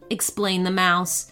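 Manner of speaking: ordinary delivery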